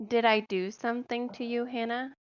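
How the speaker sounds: ordinary talk, nothing marked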